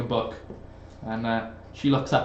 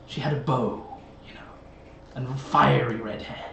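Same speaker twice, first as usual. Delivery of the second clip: crow's telepathic voice. Acted. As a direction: otherworldly crow voice, measured. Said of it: "you can hear this voice saying".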